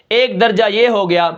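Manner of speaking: ordinary talk, nothing marked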